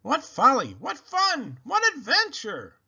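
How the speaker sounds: high, joyful exclamations; delighted. A narrator's reading. Baby thrilled by the wild ride, delighted. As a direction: relish every word. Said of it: "said Baby Lincoln".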